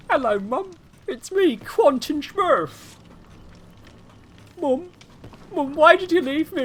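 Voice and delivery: high wailing mock-accent orphan; Quentin Smurf. Streamer as abandoned Quentin calling to mum, hammed-up high plea. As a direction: plead shrilly to mum, drawn out, hammed-up. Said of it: "I saved you from the accent".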